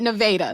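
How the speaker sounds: Nevada with long a as in "day"; rejected pronunciation. Shown as a demonstration. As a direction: say Nevada as nuh-VAY-duh. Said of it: "no one calls it Nevada".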